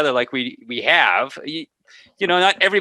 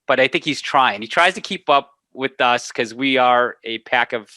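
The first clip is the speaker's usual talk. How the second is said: ordinary talk, nothing marked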